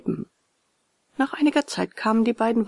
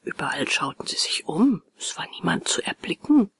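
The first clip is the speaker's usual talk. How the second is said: ordinary delivery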